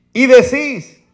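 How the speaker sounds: loud, high scripture reading over music; fiery preaching. Acted. Preacher reading Matthew to his flock, fiery. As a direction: proclaim it from the pulpit.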